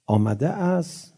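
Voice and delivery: normal talking voice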